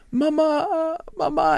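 high, drawn-out mock wail; imitated bawling. Man sees his mom after ten years, imitated bawling. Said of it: "just starts bawling".

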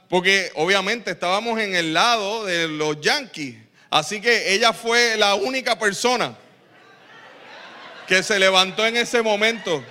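ordinary talk, nothing marked

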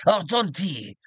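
hearty pirate captain voice. Acted. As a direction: growl it like an old sea dog. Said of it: "we'll do it in his pirate voice".

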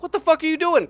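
retold high, loud outrage; outraged. Stranger to drunk roommate in the closet, mock outraged outburst. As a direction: imitate a stranger catching an intruder, indignant.

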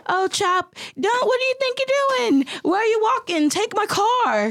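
high, hammed-up British accent, quick and eager; mock British accent. The groom offering his car to his friend, in a hammy British accent. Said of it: "That was your British accent".

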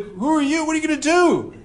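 frightened witch confronting the king, high and panicky; shocked witch. Retold or imitated. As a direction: squeal it like a startled witch. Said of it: "She's like".

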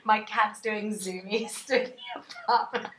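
laughing. Real, not acted. Laughing at the cat's zoomies.

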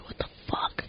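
breathless stunned whisper. Retold or imitated. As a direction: whisper it in disbelief. Retold shock at concert.

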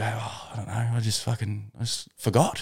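sheepish mumble. Retold or imitated. Retold sheepish excuse.

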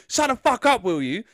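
high, loud mock yell; imitated angry outburst. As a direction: act out a fed-up man yelling at talkers. Man jumps up at talkers.

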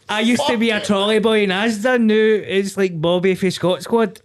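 high, loud cheesy pep talk; motivational speech. Retold or imitated. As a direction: hype up a crowd of kids. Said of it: "weird motivational speech".

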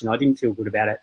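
usual speaking voice

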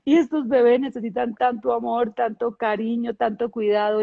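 half-crying as she retells it; tearful. Retold or imitated. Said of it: "Y yo lloré, y lloré".

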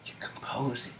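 very hushed ASMR whisper. Shown as a demonstration. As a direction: whisper softly into the microphone. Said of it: "quieter, though".

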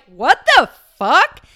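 a loud, slow, stunned curse; blurting it out in disbelief; shocked outburst. Retold or imitated. Alarmed at her own fever, a loud stunned swear.

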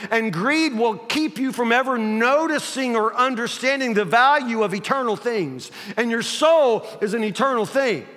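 high, loud, driving sermon; full-force preaching. Real, not acted. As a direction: preach it hard, punching the key words.

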